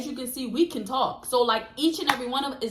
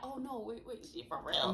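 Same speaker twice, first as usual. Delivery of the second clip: much softer and lower, under the breath; hushed realization; 5 semitones lower, 16 dB quieter than usual. Retold or imitated. Noticing her friend is really crying, hushed. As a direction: realize it quietly to yourself.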